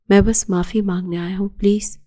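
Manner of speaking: plain reading voice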